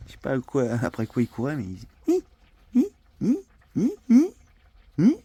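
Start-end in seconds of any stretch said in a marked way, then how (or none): 2.7-5.2 imitating whimpers. Imitating the dog whimpering in sleep.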